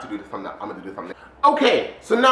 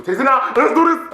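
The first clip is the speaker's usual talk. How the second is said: high, louder burst of eagerness; excited; 8 semitones higher, 6 dB louder than usual. Real, not acted. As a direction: let excitement take over, pitch climbing. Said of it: "excitement's taking over me".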